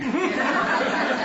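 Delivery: a high burst of laughter. Real, not acted. Preacher laughing at his joke about the talkative daughter, high laugh. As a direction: laugh at your own punchline.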